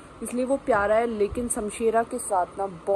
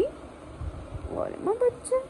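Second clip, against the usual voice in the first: very high, slow breathy whisper to the dog; sweet hushed coo; 3 dB quieter, much slower than usual. Real, not acted. Cooing at dog.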